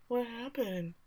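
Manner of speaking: tiny, quiet voice after a scare; small startled question. Retold or imitated. Acted son after dad's scream.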